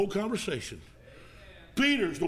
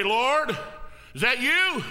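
eager call; 6 semitones higher, 4 dB louder, much slower than usual. Retold or imitated. Peter's question retold.

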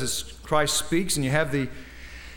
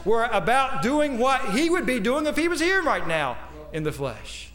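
high, building exhortation with stretched phrases; impassioned preaching; 7 semitones higher than usual. Real, not acted. Preacher urging congregation to act as Christ's representatives, impassioned. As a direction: climb in pitch as you urge the people.

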